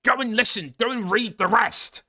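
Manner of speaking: high-pitched, whiny mock rant at an opponent; put-on high voice, mockingly exasperated; mocking Nate impression. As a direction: squeal it like an indignant arguer. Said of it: "That's what he sounded like".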